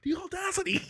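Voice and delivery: prim, high offended gasp of a phrase; high mock outrage. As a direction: play the scandalized commenter. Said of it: "when they be going off".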